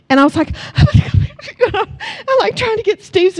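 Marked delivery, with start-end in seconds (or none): 0.6-1.7 high squealing burst of laughter; embarrassed shriek of laughter. Real, not acted. Mom at the beach with her son, laughing in high shocked squeals.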